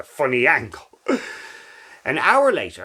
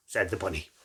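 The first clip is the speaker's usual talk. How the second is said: ordinary delivery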